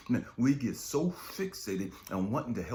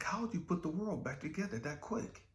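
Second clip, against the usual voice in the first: surprised dad; 11 semitones higher than usual. Retold or imitated. Dad voiced in story.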